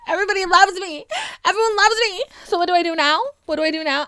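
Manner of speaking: fast wailing mock despair; mock panic. Elon panicking on stage as fans boo, near-tearful mock wail.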